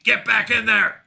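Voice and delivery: loud, hammed-up captor's command; mock stern order. Streamer playing captor to a viewer in the walls, mock stern order. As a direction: order the prisoner back, playfully forceful.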